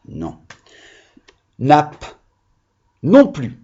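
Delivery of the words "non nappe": ordinary delivery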